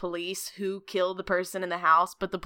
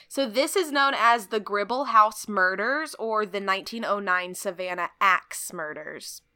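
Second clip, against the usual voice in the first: normal talking voice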